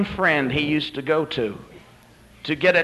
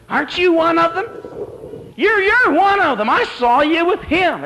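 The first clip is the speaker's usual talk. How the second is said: put-on very high, squeaky little-girl voice; 5 dB louder than usual. Little girl accusing Peter in the courtyard, squeaky voice. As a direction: pipe it out so it carries, high and squeaky. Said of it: "says in a little squeaky voice".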